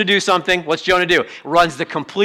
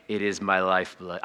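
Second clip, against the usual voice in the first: much lower and softer, dry sarcastic; deadpan it like it's obvious; mock deadpan sarcasm; 9 semitones lower, 10 dB quieter than usual. Preacher voicing sarcastic listeners, dry and low. Sarcastic people's reply voiced.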